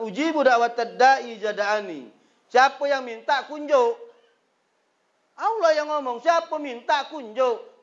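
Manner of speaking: preacher proclaims the verse to the crowd, high and loud; full-force preaching. Acted. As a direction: hammer the promise home.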